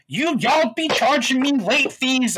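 high, drawn-out mock whine; mock caller complaint. Callers complaining about late fees, mock whining. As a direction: imitate an indignant customer, slow and high.

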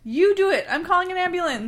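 panicked. Acted. Panicked parent refuses, calls ambulance.

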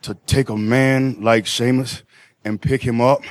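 low and unhurried, giving nothing away; deadpan answer. Acted. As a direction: answer in a deep, measured drone.

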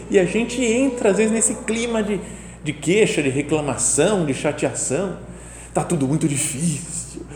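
usual speaking voice

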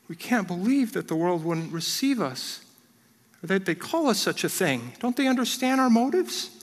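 hammed-up wounded complaint; mock hurt response. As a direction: play the pleading, misunderstood victim. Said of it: "our response is something like this".

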